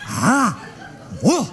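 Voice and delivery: mock Japanese.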